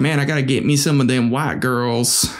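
put-on American southern accent. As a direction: quote him like a southern good old boy. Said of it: "he talked with a southern accent".

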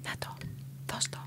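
very hushed, spaced-out whispers; barely audible ASMR delivery. Shown as a demonstration. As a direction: whisper with long pauses between phrases. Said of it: "κάνουμε A S M R".

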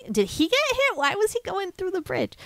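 high puzzled questions; mock confused pilot. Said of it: "chasing them is like".

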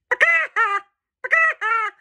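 a high hen cackle; chicken cackle. Acted. Voicing chicken laugh in book.

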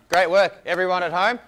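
loud, upbeat praise; excited cheer. Real, not acted. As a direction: cheer them over the finish. End of workout cheer.

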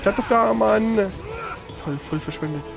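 ordinary talk, nothing marked

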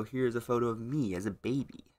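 normal talking voice

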